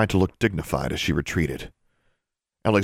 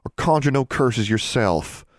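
slower, higher admonishment; admonishing; 6 semitones higher than usual. A narrator's reading. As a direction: warn against it, measured and higher. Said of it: "Forbearance admonished".

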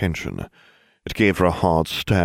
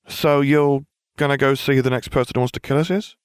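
lightly voiced Ron; 8 semitones higher than usual. A narrator's reading.

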